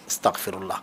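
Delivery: hushed. Real, not acted. Dismayed at the mistranslation.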